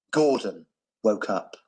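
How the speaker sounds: ordinary delivery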